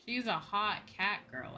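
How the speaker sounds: ordinary talk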